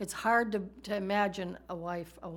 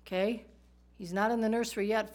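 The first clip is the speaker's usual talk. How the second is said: normal talking voice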